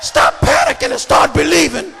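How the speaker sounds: normal talking voice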